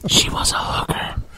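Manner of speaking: a sly whisper under the story; whispered aside. Real, not acted. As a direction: whisper the joke so only the table hears. Cheeky aside during friend's story.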